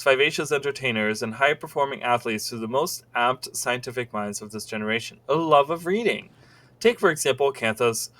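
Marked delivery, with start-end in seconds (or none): none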